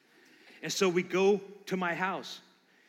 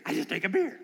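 amazed thought; 5 dB louder than usual. Retold or imitated. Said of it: "remember thinking".

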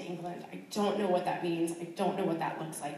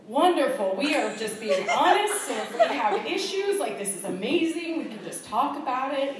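higher and much louder, laughing eagerly; delighted gush; 5 semitones higher, 8 dB louder than usual. Retold or imitated. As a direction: burst out with happy relief. Delighted reply to Steve retold.

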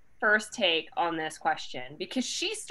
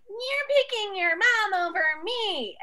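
whiny princess; 11 semitones higher, slower than usual. Retold or imitated. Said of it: "is more of a princess and is like".

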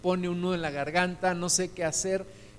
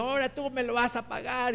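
a touch of a scolding teacher, pitched up; angry teacher; 6 semitones higher, 3 dB quieter than usual. Retold or imitated. Preacher imitating his angry teacher scolding a pupil, mildly. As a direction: mimic the cross teacher telling off the boy. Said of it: "la maestra estaba súper enojada".

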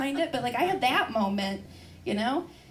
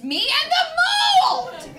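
building to a high, loud cry; mock despair; 7 dB louder than usual. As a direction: end on a hammed-up wail.

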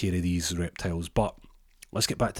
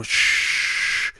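wind whoosh; 5 dB louder than usual. Retold or imitated. Wind rushing in the door.

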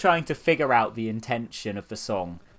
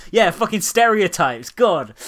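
sarcastic outrage; 6 semitones higher, 9 dB louder than usual. Pretend. Mocks poster's outrage.

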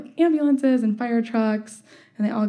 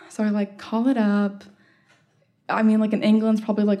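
normal talking voice

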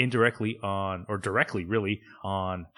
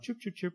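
higher, much softer little chirps; cartoon bird chirps; 10 semitones higher, 10 dB quieter than usual. A cartoon bird chirping around Daphne, soft high chirps. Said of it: "a cartoon bird that chirps around".

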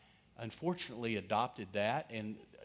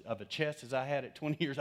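ordinary talk, nothing marked

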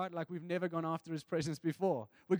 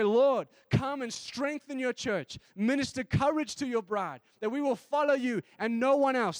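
proclaimed prayer; 5 semitones higher, 6 dB louder than usual. Acted. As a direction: declare it over the church.

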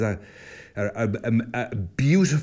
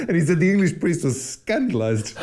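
talking through real laughter; laughing; 7 semitones higher, 3 dB louder than usual. Real, not acted. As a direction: laugh your way through the line. Laughing at his own story.

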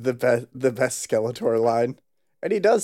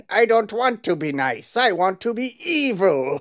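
high villain whine; Skeletor voice; 7 semitones higher than usual. Retold or imitated. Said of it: "him being like".

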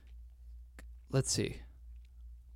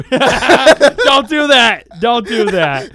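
loud, high cry of protest through laughter; laughing shouted protest; 8 semitones higher, 13 dB louder, much slower than usual. Real, not acted. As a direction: yell it high, cracking up at the prank. Laughing protest after the name prank.

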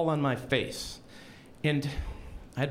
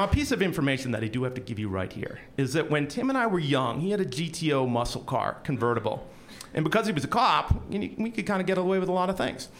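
ordinary talk

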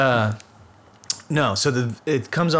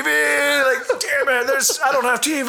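hammed-up distress; 11 semitones higher than usual. Retelling his reaction to the movie, mock wail. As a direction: wail like you can't hold back. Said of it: "I was like".

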